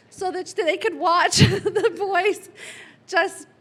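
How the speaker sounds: slow words spilling through real laughter; laughing. Real, not acted. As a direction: laugh as the memory hits. Fond memory, laughter heard.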